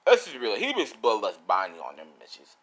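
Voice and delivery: ordinary delivery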